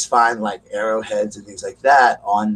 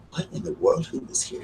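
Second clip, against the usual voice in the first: hushed, unvoiced whisper; scared whisper; 8 dB quieter than usual. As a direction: whisper as if someone's in the house. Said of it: "They're like".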